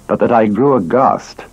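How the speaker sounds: plain reading voice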